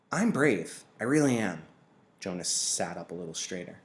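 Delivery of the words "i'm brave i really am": put-on higher, louder eager boy; a boy eager to prove he's brave; earnest young boy, pushing the point; boy insisting. A narrator's reading.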